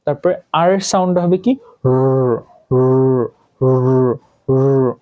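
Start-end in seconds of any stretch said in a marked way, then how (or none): 1.8-4.9 র as a sustained "rrr"; held R sound. Shown as a demonstration. Teacher modelling the sound of R, র held as "rrr". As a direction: hold র on a level note, each separate. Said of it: "র এর মতো sound হবে".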